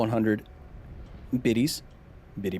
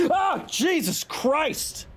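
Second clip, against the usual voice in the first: high shriek of real fright; jump-scared yelling; startled scream; 10 semitones higher, much slower than usual. Real, not acted. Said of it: "It was scary".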